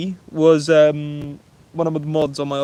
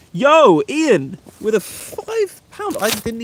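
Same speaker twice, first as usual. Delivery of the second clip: loud, high burst of delighted shock; excited surprise; 7 semitones higher, 5 dB louder, much slower than usual. Real, not acted. Streamer reacting to a first surprise donation, excited. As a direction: call it out high, stretching the amount.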